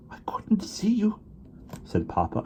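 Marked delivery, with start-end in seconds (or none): 0.1-1.2 high, slow, breathless whisper; a father who feared losing his family; choked whisper. A narrator's reading. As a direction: whisper it, overcome with relief. Papa overwhelmed, whispering.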